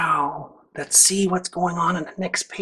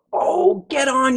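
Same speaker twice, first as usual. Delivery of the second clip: higher, louder mock yell; mild yell; 7 semitones higher, 4 dB louder than usual. Reader voicing Fred yelling to the crew, mock yell. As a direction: call it out like a cheerful fireman. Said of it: "yells Fred".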